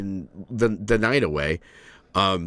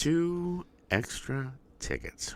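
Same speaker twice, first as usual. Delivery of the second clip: mock echo; 7 semitones higher, 8 dB quieter, much slower than usual. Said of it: "he's rambling".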